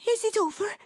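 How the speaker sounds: high, eager question; anxious cry. A narrator's reading. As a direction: ask urgently in a lifted voice. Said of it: "cried Dot".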